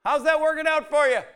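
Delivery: high, loud call across the water; yelling out. Retold or imitated. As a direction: shout it from the shore, teasing. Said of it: "he yells out in the morning".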